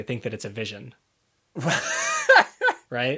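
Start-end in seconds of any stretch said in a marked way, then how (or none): 1.6-2.8 high squealing burst of laughter; real laugh breaking out high; cracking up at the plan; shrieking laugh. Real, not acted.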